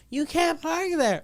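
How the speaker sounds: high, slow, prissy imitation; mock meter maid scolding; snooty mock scold. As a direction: mimic a nagging busybody. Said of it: "I hate people that tell you".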